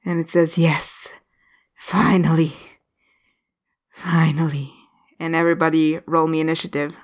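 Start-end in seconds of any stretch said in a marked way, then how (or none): 0.6-4.7 creepy undead whisper. Acted. Undead figure speaks to party.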